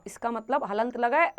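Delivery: usual speaking voice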